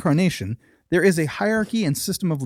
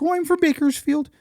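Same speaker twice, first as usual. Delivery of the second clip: high, twangy Wisconsin-like cashier voice; strange Wisconsin-like accent; 11 semitones higher than usual. Acted. Cashier telling where she's from, strange Wisconsin-like accent. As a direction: answer in an odd, high Wisconsin-style accent. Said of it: "where's your accent from".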